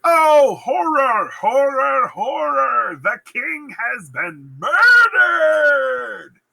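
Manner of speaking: high, loud wail of horror; hammed-up shriek, slow and drawn-out at the end; horrified cry. Macduff announcing the king's murder, hammed-up horrified wail. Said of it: "he's like".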